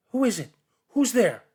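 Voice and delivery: high, loud frightened call; scared call. Acted. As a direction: shout into the dark to find who's there. Child calling out in the dark.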